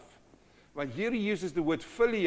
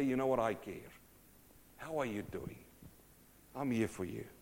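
caring friend; 5 semitones lower, 8 dB quieter than usual. Acted.

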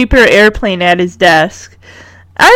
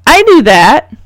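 know-it-all interjection; 5 semitones higher, 5 dB louder than usual. A narrator's reading. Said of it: "has to add her two cents".

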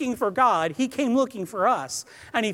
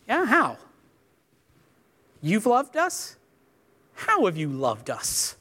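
sarcastic paraphrase. Pretend. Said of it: "the new Brian paraphrase".

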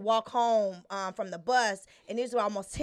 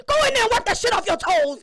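higher, louder and fast scolding mom; stern mom order; 9 semitones higher, 7 dB louder, faster than usual. Retold or imitated. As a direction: bark it like a fed-up mother. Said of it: "She just said".

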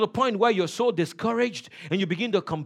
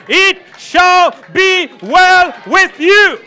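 drawn-out declaration; 11 semitones higher, 18 dB louder, much slower than usual. Shown as a demonstration. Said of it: "say it like you mean it".

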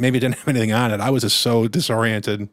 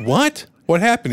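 surprised wife; 8 semitones higher than usual. Retold or imitated. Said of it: "she's like".

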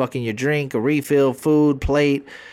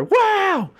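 high, excited infomercial wow; infomercial host; 4 dB louder than usual. Retold or imitated. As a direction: sell it like a TV pitchman. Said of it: "seal commercials".

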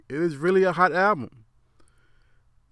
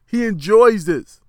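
laughing; 6 semitones higher, 11 dB louder, slower than usual. Real, not acted. Laughs while praising Fifty.

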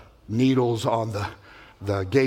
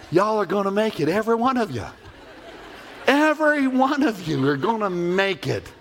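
laughing reassurance; 6 semitones higher than usual. Acted. Reassuring passengers on screen.

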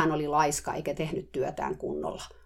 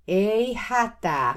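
slow, high, loud call; louder shout; 6 semitones higher, 5 dB louder, much slower than usual. Retold or imitated. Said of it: "mä huusin uudelleen kovemmalla äänellä". (kovempi, hidas huuto)

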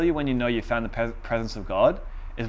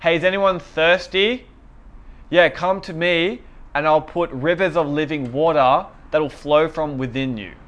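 loud, higher-pitched call to a crowd; yelling out; 4 semitones higher, 5 dB louder than usual. Acted. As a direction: shout the invitation out to everyone. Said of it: "starts yelling out to everyone".

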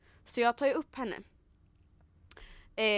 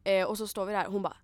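usual speaking voice

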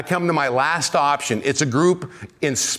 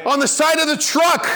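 incredulous shout; 10 semitones higher than usual. Real, not acted. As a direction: shout it like you can't believe it. Punchline shouted in disbelief.

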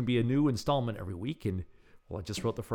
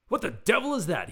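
high, loud, exclaimed in alarm; startled bark; 11 semitones higher, 7 dB louder than usual. A narrator's reading. Inventor startled by a knock, barking to himself. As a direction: bark it out to yourself. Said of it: "he barked to himself".

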